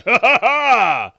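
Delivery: high, loud, slow cackle; drawn-out mocking "ha, ha, ha"; ogre's gloating laugh. Ogre gloats after hitting Bing, loud mocking laugh.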